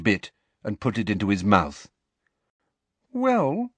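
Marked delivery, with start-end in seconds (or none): 3.1-3.7 put-on high-pitched, hesitant girl; Ginny, slowly. A narrator's reading. Ginny weighing up Harry's wish, slowly. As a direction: draw out a tentative high opening. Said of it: "said Ginny slowly".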